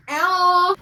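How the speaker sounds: playful whoop. Acted. Showing off her kicks.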